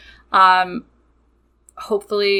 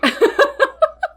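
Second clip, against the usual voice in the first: high laugh; 3 dB louder than usual. Real, not acted. Laughing at her own delusion.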